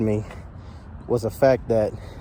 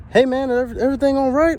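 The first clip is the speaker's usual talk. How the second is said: much higher pitched, friendly guy asking; high helpful stranger voice; 11 semitones higher, 3 dB louder, faster than usual. Retold or imitated. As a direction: imitate the concerned stranger, pitched up. Stranger voiced in retold story.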